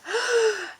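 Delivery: sharp high intake of breath; a quick gasp. A narrator's reading. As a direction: gasp before the leap. Frog takes deep breath.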